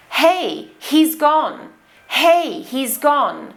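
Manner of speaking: "Hey, he's gone" with audible H's; pronounced H. Shown as a demonstration. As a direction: clear breathy H starting "Hey" and "he's". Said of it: "with the pronounced H sound".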